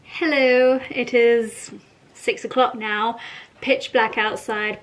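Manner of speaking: ordinary talk, nothing marked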